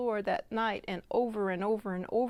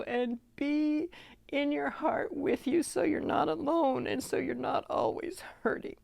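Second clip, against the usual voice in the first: emotional; 3 semitones higher than usual. Real, not acted. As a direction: speak through tears of gratitude, pitched up. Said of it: "Sorry, I'm so emotional".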